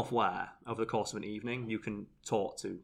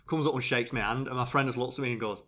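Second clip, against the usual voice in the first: ordinary talk, nothing marked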